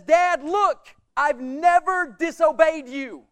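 loud, slow, stubborn protest to his father; older son loudly protesting to his dad; indignant complaint. Retold or imitated. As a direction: lay out your record like a wounded son. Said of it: "He says".

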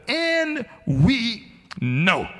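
"And we know" high and drawn out at half speed; emphatic. Acted. Preacher quoting Paul against a mere inkling, emphatic. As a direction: declare "And we know" word by word, no doubt. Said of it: "Paul is emphatic".

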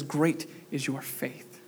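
God quietly affirms the humble woman's faith; gentle blessing. Acted. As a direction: let it fall quietly and kindly. God's tender reply.

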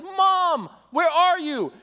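mild imitation of a child calling for mom; little boy crying out. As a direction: call out like a scared small child. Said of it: "he's like".

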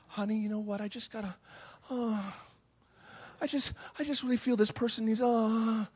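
very soft, halting plea with little sighs; hushed, hammed-up sweet hesitation; imitated pleading wife. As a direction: coax a spouse gently, mimicking her. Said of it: "she had those eyes".

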